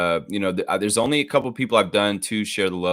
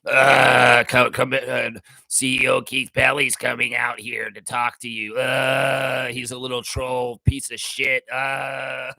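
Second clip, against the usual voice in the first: mock-pompous announcer voice, lightly put on; lightly voiced smug announcer; gross corporate announcer; 3 semitones higher, 3 dB louder, slower than usual. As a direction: sneer through a fake corporate intro. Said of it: "corporate clone of him with their gross voice".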